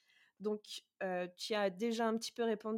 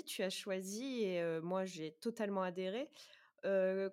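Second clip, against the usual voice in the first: usual speaking voice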